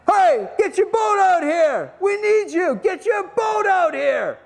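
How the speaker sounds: high, drawn-out calling shout; yelling to shore. Acted. Peter calling James and John for help, long high yell. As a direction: holler it across the water, stretching the words. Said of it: "and they yell to them".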